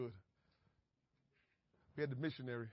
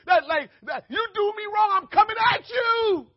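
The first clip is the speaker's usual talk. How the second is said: fighting shout; 18 dB louder than usual. Acted. Acting out the urge to strike back, a loud high shout. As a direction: yell it like you're squaring up.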